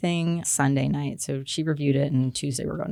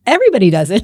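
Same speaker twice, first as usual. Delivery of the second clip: loud, higher-pitched exasperated protest; exasperated retort; 5 semitones higher, 11 dB louder, much faster than usual. Retold or imitated. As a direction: argue it loudly, as if it were obvious. Retold argument over date night.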